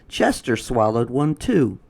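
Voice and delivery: usual speaking voice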